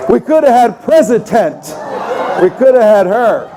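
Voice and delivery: emphatic outburst. Real, not acted. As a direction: exclaim it in mock horror.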